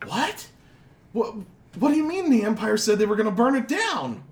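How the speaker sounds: crowd disbelief. Acted. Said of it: "People are like".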